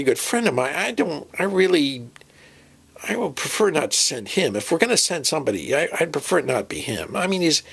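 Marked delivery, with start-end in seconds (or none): none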